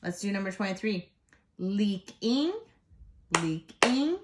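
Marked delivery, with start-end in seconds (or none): 1.6-4.2 "leaking" split, rising sharply on the second part; syllables checked. Shown as a demonstration. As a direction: beat out each syllable of "leaking". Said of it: "double check all of our sy-, syllables".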